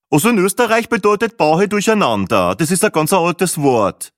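high, loud know-it-all; smug Peppi. A narrator's reading. Peppi lectures the guide, smug and grinning. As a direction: explain it with a grin. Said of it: "naseweiß grinsend".

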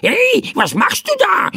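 very high, startled crow shriek; squawking bird. A narrator's reading. Bird doused by the boy cries out, shrill squawking. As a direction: squawk out an indignant protest. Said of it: "rief der Vogel".

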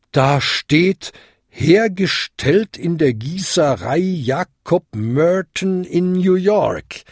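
high, bell-like reading aloud; hollow ringing. A narrator's reading. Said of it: "Ding läutete hohl". (hoch läutend, am Ende gedehnt)

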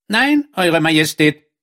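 high frog character voice; talking frog. A narrator's reading. Frog contradicts the king politely, high creature voice.

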